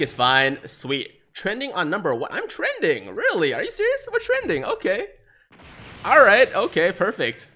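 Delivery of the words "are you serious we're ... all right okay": ordinary delivery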